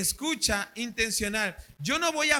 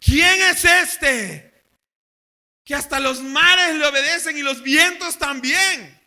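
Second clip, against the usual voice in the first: loud, slow, high awe; awed disciples asking who this man is; astonished disbelief; 4 semitones higher, 10 dB louder, slower than usual. Retold or imitated. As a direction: gasp it out in amazement. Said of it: "en su corazón está diciendo".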